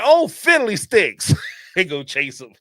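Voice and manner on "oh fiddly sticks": prissy killer curse. Retold or imitated. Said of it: "He be like".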